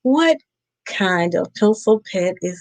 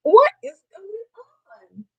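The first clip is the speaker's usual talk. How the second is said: mock panic; 14 semitones higher, faster than usual. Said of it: "He's like".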